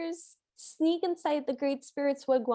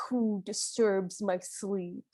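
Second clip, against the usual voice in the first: mock thunderous voice; 6 semitones lower, 4 dB quieter, slower than usual. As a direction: drop low and slow like a waking giant. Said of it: "this, like, thunderous voice goes".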